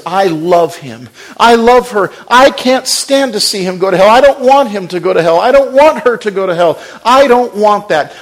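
a hint of God pleading with rising urgency. Preacher voicing God refusing to lose sinners, pleading. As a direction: voice a loving God who cannot bear loss.

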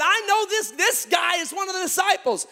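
high, loud accusing cry; accusing shout. Retold or imitated. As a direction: point him out loudly to the crowd. Accuser points out Peter.

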